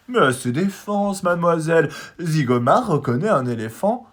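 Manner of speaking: high, loud, final-sounding bird voice; brooking no reply. A narrator's reading. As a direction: settle it once and for all. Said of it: "d'un ton sans réplique".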